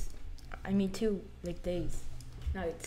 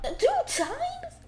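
high, slow, startled question; startled disbelief; 5 dB louder, slower than usual. Real, not acted. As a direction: gasp it out high and drawn-out. Startled by something in game.

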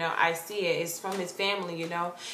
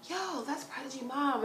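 soft, high hushed voice; discreet tip-off; 5 semitones higher, 10 dB quieter than usual. Retold or imitated. Friend pointing out [name]'s mom, hushed. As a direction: tip someone off under your breath.

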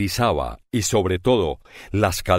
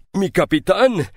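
a pirate overjoyed to see his captain; moved cry, rising; 10 semitones higher than usual. A narrator's reading. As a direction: push the second cry up with emotion.

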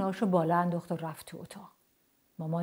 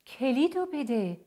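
higher, slow, drawn-out demand; stern demand; 6 semitones higher, slower than usual. A narrator's reading. Mum demands the key from dad, stern drawn-out order. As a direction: demand it, stretching the words.

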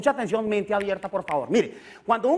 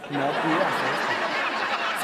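"No pidas esto" said very slowly and much lower; slow deep warning; 8 semitones lower, much slower than usual. Shown as a demonstration. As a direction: drag out a mock ominous warning. (advertencia lenta y grave de broma)

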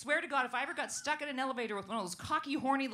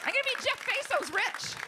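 gleeful boast; 13 semitones higher, 5 dB louder than usual. Real, not acted. Boasting, high and loud.

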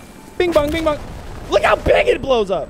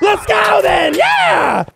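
high loud rallying yell; hyped shout; 12 semitones higher, 8 dB louder, much slower than usual. Real, not acted. Gamer rallying the squad to attack, excited shout. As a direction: fire the squad up.

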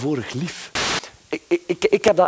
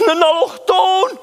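shout; 4 dB louder, slower than usual. Acted. Comic reveal shouted.

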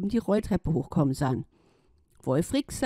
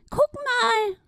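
very high duck cry. Acted. Duck spots the giant car, high cry. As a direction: call out high as the little duck. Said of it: "schrie die Ente".

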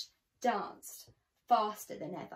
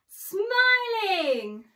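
very slow, higher, louder sing-song; drawn-out happy ending; 9 semitones higher, 9 dB louder than usual. A narrator's reading. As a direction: stretch the last word like a smile. Happy ending, smiling stretched out.

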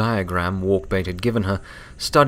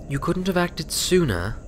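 peevish; 10 semitones higher than usual. A narrator's reading. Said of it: "Twilight asked peevishly".

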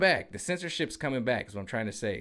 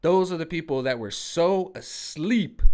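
usual speaking voice

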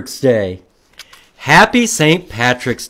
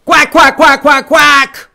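rapid volley of loud, high quacks; mad duck; 12 semitones higher, 9 dB louder than usual. Acted. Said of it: "Mad duck".

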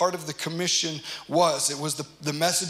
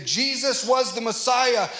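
emphatic proclamation; 6 semitones higher, 3 dB louder, slower than usual. Acted.

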